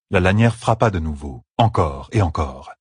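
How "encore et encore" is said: plain reading voice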